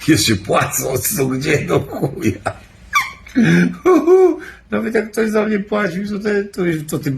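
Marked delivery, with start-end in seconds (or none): 2.9-4.7 loud high-pitched burst of laughter. Real, not acted. Bursts out laughing at own joke. 4.7-7.2 talking through a high laugh; words squeezed out laughing. Real, not acted. Still laughing while talking.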